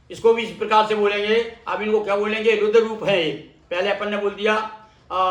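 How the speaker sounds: usual speaking voice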